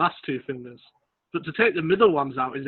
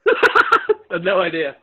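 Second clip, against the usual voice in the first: slow, laughing through the words; 2 semitones higher, 6 dB louder, much slower than usual. Real, not acted. Friend laughing at not knowing [name]'s name, laughing. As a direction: dissolve into a laugh on it.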